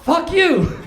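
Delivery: loud, high "Fuck you"; a hammed-up shout of the insult; loud mock curse. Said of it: "He's been saying".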